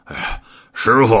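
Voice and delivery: puffed-out cry to master; out of breath. A narrator's reading. Tired disciple running back to his master, huffing. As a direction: call out as if winded from battle. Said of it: "气呼呼的走将来".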